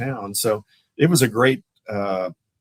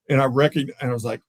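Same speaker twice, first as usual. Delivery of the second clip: ordinary talk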